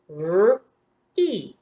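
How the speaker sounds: "R-E" as separate drawn-out sounds, rrr, then ee. Shown as a demonstration. Teacher taps those sounds for pupils, segmented phonemes. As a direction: sound out "R-E" phoneme by phoneme, not letter names. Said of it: "Tap those sounds".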